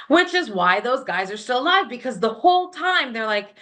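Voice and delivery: ordinary talk, nothing marked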